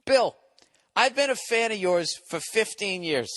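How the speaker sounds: a hint of a simpering fan reading his letter; mock earnest fan. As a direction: read it like a sappy devoted fan, lightly put on. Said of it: "Bill, just read the fucking email".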